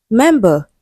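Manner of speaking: "Member" with a strong first syllable, light second; stress on first syllable. Shown as a demonstration. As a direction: lean on MEM in "Member". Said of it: "stressed on the first syllable".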